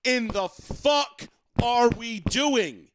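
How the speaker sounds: slow, loud, high exasperation; exasperated outrage. Real, not acted. Host fuming about the foul review to cohost, slow and loud. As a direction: drag it out in disbelief.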